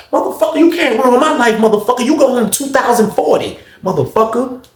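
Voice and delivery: high mock-furious comeback; acted angry retort. Officer taunting inmate from the steps, angry. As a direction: cuss him out as the officer.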